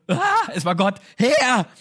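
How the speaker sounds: high, loud hysterical outburst; freaking out. Retold or imitated. Gideon realizing he saw the Lord's angel, hysterical shout. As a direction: shout it out, losing all composure. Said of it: "sondern er rastet aus".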